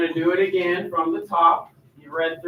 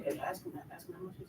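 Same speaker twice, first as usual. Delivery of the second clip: hushed whisper to a partner; whispered aside; 20 dB quieter, much faster than usual. Acted. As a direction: whisper a discreet prompt. Adviser quietly coaches the buyer.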